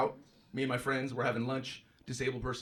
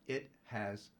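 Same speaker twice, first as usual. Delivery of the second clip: condescending; 8 dB quieter, much slower than usual. Retold or imitated. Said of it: "She looked at me like I was the dumbass".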